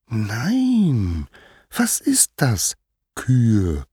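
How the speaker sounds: put-on high, drawn-out childlike question; slow, high mock puzzlement; innocent little Hermes. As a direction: coo innocently as if clueless. Hermes keeps playing innocent.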